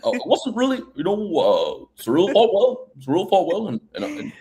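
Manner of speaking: high-pitched, halting British fighter voice; mock hesitant interview answer; Tom Aspinall impression. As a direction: hem and haw as a British fighter, fading out. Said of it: "I think you have a great Aspinall".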